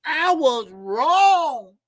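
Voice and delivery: high, loud, slow mock confession; mocking Tim Bradley. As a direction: ham up a tearful apology, drawn out. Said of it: "Tim Bradley came out and said".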